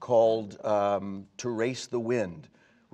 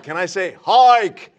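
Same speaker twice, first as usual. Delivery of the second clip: shouted question; 6 semitones higher, 11 dB louder, slower than usual. Retold or imitated. Asks director, retold shout.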